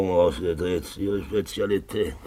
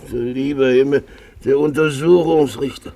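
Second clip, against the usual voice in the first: higher and louder mock surprise; boisterous drunk greeting; 8 semitones higher, 5 dB louder than usual. Drunk man hailing the magistrate and his companion, loudly. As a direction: boom it out like a jolly drunk.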